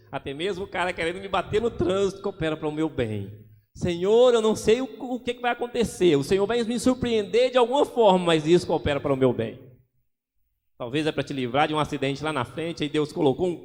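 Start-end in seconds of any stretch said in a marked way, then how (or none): 3.7-9.7 louder, trusting cry to the Lord; fervent prayer. Retold or imitated. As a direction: declare it loud, full of faith. Loud prayer in traffic.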